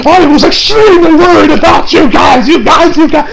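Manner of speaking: high, loud frantic shout; overwhelmed class rep yelling his relief; Ida's worried outburst. Retold or imitated. Ida shaking Izuku down with relief, high frantic shout. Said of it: "He's like".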